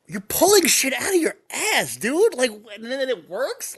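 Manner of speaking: high-pitched mock disbelief; incredulous. Podcaster mock-arguing with the TV doctor, incredulous.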